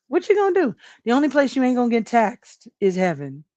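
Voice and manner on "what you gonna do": much higher, sing-song shrug; high repeat. Shown as a demonstration. Vlogger repeating the shrug to chat, pitched high. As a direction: toss it up high, resigned.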